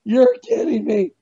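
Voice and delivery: very high, slow squeal of disbelief; stunned disbelief. Retold or imitated. Telling Zen where the altar is, a high stunned squeal. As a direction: stretch it out, floored by the news. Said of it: "I was like".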